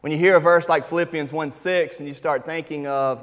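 normal talking voice

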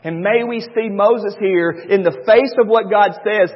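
ordinary delivery

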